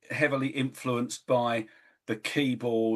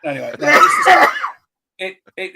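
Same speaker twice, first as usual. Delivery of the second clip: flustered laughing; 6 semitones higher, 11 dB louder than usual. Real, not acted. Flustered reply to teasing, laughing.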